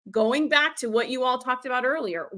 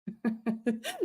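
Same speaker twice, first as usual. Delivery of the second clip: real laugh; 10 dB quieter than usual. Real, not acted. Laughing at Nadia's comment.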